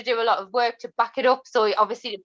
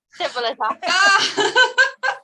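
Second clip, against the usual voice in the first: laughing; 5 semitones higher, 3 dB louder, much slower than usual. Real, not acted. Laughing emphatic end.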